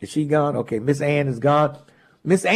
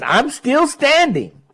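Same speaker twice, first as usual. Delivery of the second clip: dramatic reading; 10 semitones higher, slower than usual. Retold or imitated. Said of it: "and it says".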